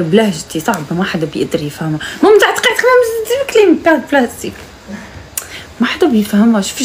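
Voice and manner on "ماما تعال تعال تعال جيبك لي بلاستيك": high urgent call for mom. Real, not acted. Daughter urgently calls her mother to bring plastic, high call.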